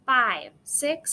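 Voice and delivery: ordinary delivery, nothing marked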